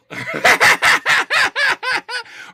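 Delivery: high laughter at the question. Real, not acted.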